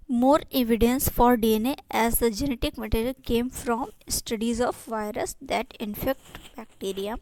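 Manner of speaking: reading the English line out slowly, word by word; slow textbook reading. Shown as a demonstration. Teacher reading the textbook aloud to students, slow dictation. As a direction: spell it out for the class like dictation.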